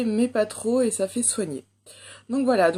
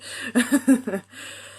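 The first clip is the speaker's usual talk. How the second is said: higher burst of giggling; amused laugh; 4 semitones higher, 4 dB louder than usual. Real, not acted. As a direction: laugh at your own bad accent. Laughs at own English accent. (éclat de rire amusé)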